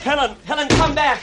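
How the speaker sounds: high, loud desperate call; calling after her. Acted. Richard calls after Helen as she runs from police, loud anguished call.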